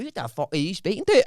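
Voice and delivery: high-pitched mock-indignant Scottish accent. Worker mimicking the Scottish caller back, Scottish accent. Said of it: "giving it back in his own accent".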